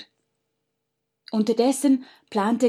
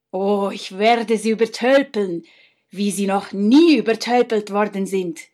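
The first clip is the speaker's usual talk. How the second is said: scheming brother; 5 dB louder than usual. Acted. Brother scheming aloud in story.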